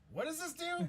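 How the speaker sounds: high, baffled mock question; confused voice. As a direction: play it clueless and high. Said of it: "we would just be like".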